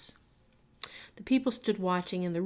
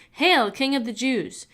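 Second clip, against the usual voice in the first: mocking hail; 5 semitones higher, 7 dB louder, slower than usual. A narrator's reading. Soldiers mock Jesus with robe.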